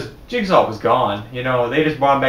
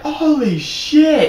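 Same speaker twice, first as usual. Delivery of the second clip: high, drawn-out disbelief; reliving the reveal in the theater; stunned gasp; 12 semitones higher, much slower than usual. Retold or imitated. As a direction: let it stretch out in shock. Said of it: "I was like".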